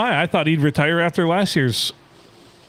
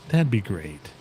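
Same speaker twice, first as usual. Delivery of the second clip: Bill Lumbergh; 5 semitones lower, 5 dB quieter, slower than usual. Retold or imitated. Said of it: "Bill Lumbergh style".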